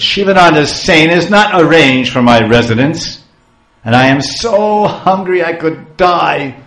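loud, outraged storyteller's curse; angry cursing. Acted. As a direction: hurl the curse loudly, hammed-up fury. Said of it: "here he's speaking some ill names".

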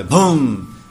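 loud mock thunder boom. Imitating thunder in story.

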